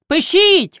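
high, loud call; crowd call. A narrator's reading. The meeting calls to the elder to write it down. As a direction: call it out firmly. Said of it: "гука громада".

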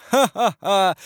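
high, spelled-out "ha-ha-ha" laugh; loud theatrical burst of laughter; acted laugh. A narrator's reading. King's acted laugh at his brother.

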